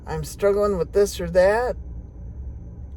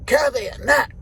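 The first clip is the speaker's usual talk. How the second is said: mom calling; 6 semitones higher, 4 dB louder than usual. Retold or imitated. Mom calling her from doorway.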